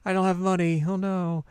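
high mock fretting; a hint of distressed worry; fretting Ren.

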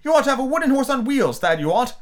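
높고 크게 짜증 섞인 외침; 참다못해 터뜨리듯; exasperated cry. A narrator's reading. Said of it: "Alice cried".

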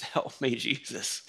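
quiet, dropping plea; hushed desperate plea. Shown as a demonstration. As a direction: murmur it like a sigh. Example of simple prayer.

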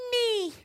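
tiny high-pitched squeak of a nee; thin high nose-whistle nee; high whistling nee. Retold or imitated. Boys keep disrupting.

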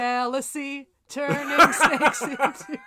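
loud, slow words broken by laughter; laughing. Real, not acted. Cohost laughing through his own joke, loud and slow. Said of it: "That was good".

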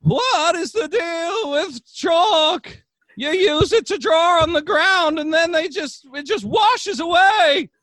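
very high, loud, drawn-out stand-up caricature; Seinfeld. Acted. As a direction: belt out slow, exaggerated observational comedy in an American voice. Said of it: "worked on my Seinfeld impression".

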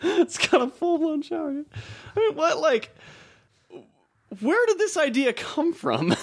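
squeaky high incredulous voice; high mock outrage. To his cohost about the spa, in a high mock-outraged voice.

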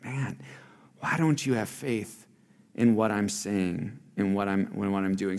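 soft, low, let-down plea; heartbroken. Retold or imitated. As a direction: quietly voice a breaking heart, acted lightly. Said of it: "Jesus' heart just kind of break".

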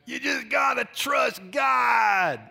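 high, loud, dragged-out preacher impression; mock revival shout with a long last word; raspy preacher. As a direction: belt it out slowly like a hoarse preacher. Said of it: "I heard a preacher say that".

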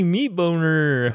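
drawn out high silly name; high slow cartoon voice; goofy inner voice. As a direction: slowly announce himself as a dumb alter ego. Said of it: "He does all these voices in his head".

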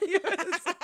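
ordinary talk

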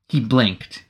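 ordinary delivery, nothing marked

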